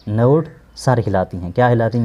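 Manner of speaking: ordinary talk, nothing marked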